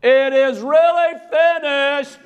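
high, strained, drawn-out holler; insistent cue call. Acted. Stage Jesus desperate for the late soldier, hollering high. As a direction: holler the cue pointedly, stretching the words. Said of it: "he raises his head again and goes".

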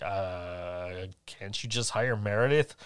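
low, soft, reluctant objection; reluctant reply. Retold or imitated. Photographer balking at partner's request, low and reluctant. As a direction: drag out a low "uh", then quietly balk.

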